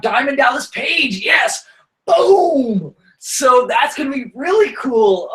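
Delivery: high, excited hype voice with a cry of boom; host hyping a wrestling guest, excited; hyped-up wrestling call. Real, not acted. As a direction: pump up the crowd for a wrestling star.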